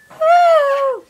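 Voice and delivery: high, loud happy whoop; excited whoop. Real, not acted. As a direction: whoop with delight.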